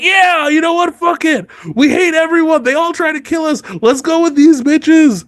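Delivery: squeaky gung-ho yokels cheering; rowdy river lords. Retold or imitated. River lords joining Robb, high rowdy mock cheer.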